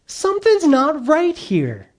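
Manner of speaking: a lightly voiced worried villager, drawn out; puzzled Jericho townsman. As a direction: play a baffled townsman noticing something wrong. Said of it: "they're like".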